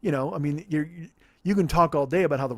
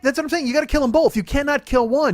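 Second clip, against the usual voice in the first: much higher and louder, emphatic; hammed-up passion about killing both dogs; mock insistence; 9 semitones higher, 5 dB louder than usual. Insisting to his cohost that both dogs die, high and emphatic.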